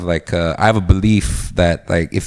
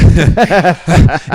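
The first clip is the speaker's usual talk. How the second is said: loud laugh; 6 semitones higher, 5 dB louder than usual. Real, not acted. Laughing at the impression.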